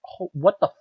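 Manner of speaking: rising mock rage at the cartoon's writers; retold angry outburst. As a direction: rant at the TV, half-joking fury. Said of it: "I'm, like, actually angry".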